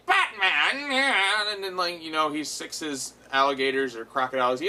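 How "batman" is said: higher, loud villain cry of the hero's name; hammed-up villain shout; villain's outraged cry. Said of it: "he's like".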